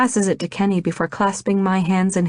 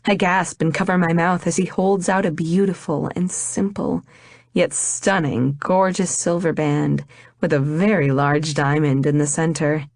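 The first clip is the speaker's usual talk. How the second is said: plain reading voice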